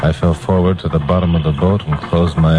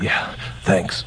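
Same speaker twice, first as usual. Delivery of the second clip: faint, voiceless, worn out; parched whisper; 6 dB quieter than usual. Acted. Castaway thanking the fisherman for water, parched whisper. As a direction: barely get the words out, dying of thirst.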